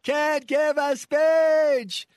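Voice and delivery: mock shouting speech. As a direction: ham up a candidate yelling at a rally. Host mocks Clinton's shouty speaking.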